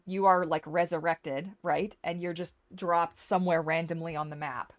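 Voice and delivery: ordinary talk, nothing marked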